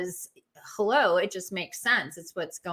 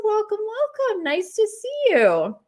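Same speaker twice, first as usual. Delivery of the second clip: excited greeting; 14 semitones higher, 6 dB louder than usual. Real, not acted. Greeting a guest joining live.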